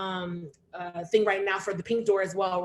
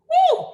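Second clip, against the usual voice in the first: cheering whoop; 10 dB louder than usual. Real, not acted. Host cheers after poem.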